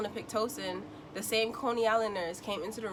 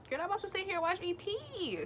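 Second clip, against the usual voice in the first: much higher, incredulous scolding; mock outrage; 11 semitones higher than usual. Patient telling the nurse she will not watch her pee, high incredulous voice. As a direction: put her in her place.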